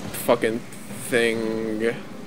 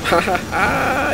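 mocking laugh; 7 semitones higher, much slower than usual. Real, not acted. Mocking enemy that hit a wall.

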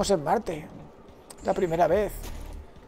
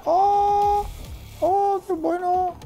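much higher, slow amazed oh; delighted oh; much slower than usual. Real, not acted. Gamer amazed by something in the game, high and slow. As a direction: gasp in delight.

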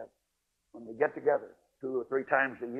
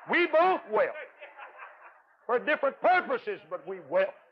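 mock weeping; 8 semitones higher, 5 dB louder than usual. Recalling weeping with Thelma.

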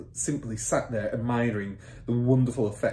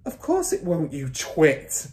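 scornful mother; 6 semitones higher, 4 dB louder, slower than usual. A narrator's reading. Mother snubs husband.

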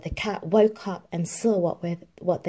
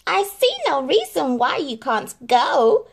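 higher, louder, sly voice; sly stepmother; 7 semitones higher, 6 dB louder than usual. A narrator's reading. Stepmother teases Cinderella, sly higher voice. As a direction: purr it with false kindness. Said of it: "said the stepmother slyly".

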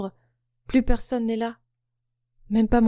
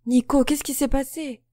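anxious; 6 semitones higher than usual. A narrator's reading. Panicked sister to brother.

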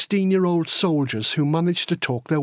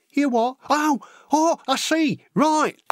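put-on high-pitched boyish dawning realisation; baffled squeak into getting it; puzzled, then twigging; 11 semitones higher than usual. Boy catching the sergeant's hint, high and surprised.

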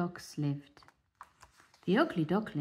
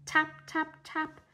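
Mother Duck; 10 semitones higher than usual. Acted. Mother Duck sees eggs hatch.

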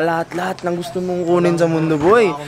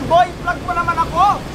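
playful plea; 9 semitones higher than usual. Real, not acted. Friend begging to be vlogged.

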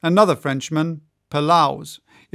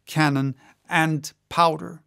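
plain reading voice

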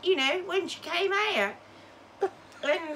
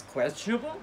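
K D; 7 semitones lower, 6 dB quieter, faster than usual. Retold or imitated. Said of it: "And Katie goes".

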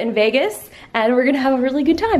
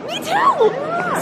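very high, squealing with delight; excited squeal; much slower than usual. Real, not acted. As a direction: squeal it, thrilled. Excited to see herself featured.

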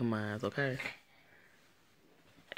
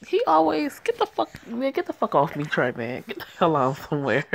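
outraged yell; 7 dB louder than usual. Real, not acted. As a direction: shove the character off with your voice. Yelling at the character.

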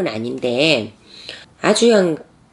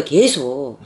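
ordinary talk

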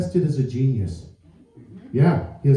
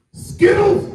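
sudden very high shout; shrieked high, out of nowhere; manic shout. Retold or imitated. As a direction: yell the word like a crazed stranger. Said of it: "looks me straight in the eyes and goes".

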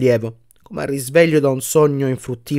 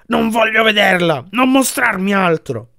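anguished outcry; 7 semitones higher than usual. A narrator's reading. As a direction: cry out in anguish, begging it to stop. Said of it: "esclamò Scrooge".

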